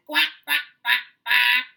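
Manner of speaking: big quacking duck voice; loud duck quacks. Retold or imitated. Teacher voicing mother duck for the children, loud quacks. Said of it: "mother duck said".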